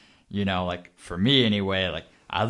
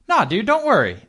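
higher, louder playful brush-off; mock breezy reassurance; 9 semitones higher, 5 dB louder than usual. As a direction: wave away the worry, hammed up. Acting out a breezy sales reassurance.

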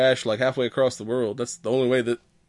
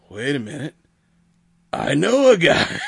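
dramatic hero line; 2 semitones higher, 3 dB louder than usual. Retold or imitated. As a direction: land it like the big movie moment. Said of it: "And Chris Pratt's again like".

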